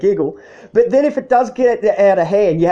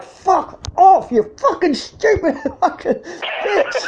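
high, slowing mock yell breaking into a laugh; high mock shout; 9 semitones higher, much slower than usual. Man yelling at a woman who keeps hitting him, laughing. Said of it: "he's just going like".

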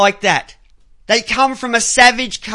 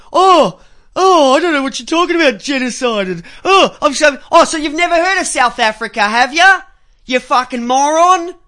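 higher, louder, fast mock voice; mocking imitation; 3 semitones higher, 4 dB louder than usual. Host imitating media figures he mocks, higher and rushed. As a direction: ham up a smug dismissive reporter. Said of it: "come out and actually say".

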